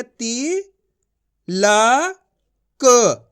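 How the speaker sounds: ती, ला, क as separate drawn-out sounds with pauses; syllable by syllable. Shown as a demonstration. Teacher showing learners each letter's sound, syllable by syllable. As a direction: sound out ती ... ला ... क one at a time. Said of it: "एक एक अक्षर का उच्चारण करके".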